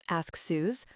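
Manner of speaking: ordinary delivery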